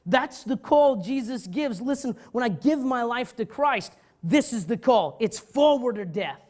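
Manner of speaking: slow, loud, rousing sermon cry; preaching at full force. Acted. Preacher urging the congregation, slow and loud. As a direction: drive the call home to the church.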